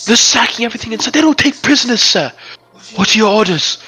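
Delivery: high, loud, breathless report to his commander; frightened messenger pleading for orders; panicked soldier. Acted. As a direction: blurt the bad news in a panic.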